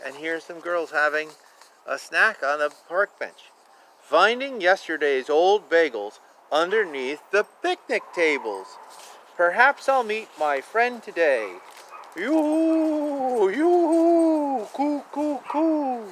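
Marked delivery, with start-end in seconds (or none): 4.0-11.6 gentle sing-song picture book read; lilting storybook narration; reading aloud. Acted. 12.2-16.1 high hooting bird call; playful high cooing; pigeon coo. Pretend. Imitating bird calls in rhyme.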